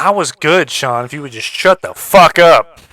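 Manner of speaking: loud mock-angry yell; shouting. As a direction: blow up at him for laughs. Mock outburst at cohost.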